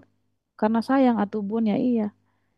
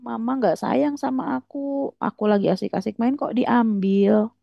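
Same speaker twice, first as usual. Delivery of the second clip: hammed-up wail to mama, pitched up; mock crying child; 4 semitones higher than usual. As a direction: play a tearful, pleading little kid. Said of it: "anak Anda nangis".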